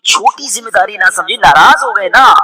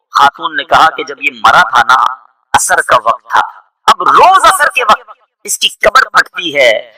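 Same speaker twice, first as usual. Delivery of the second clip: ordinary talk, nothing marked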